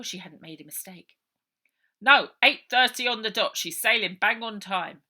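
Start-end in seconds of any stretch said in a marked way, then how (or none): 2.0-5.0 loud official brushing them off; a touch of the self-satisfied gateman; gate man voice. Acted. Gate man refuses entry.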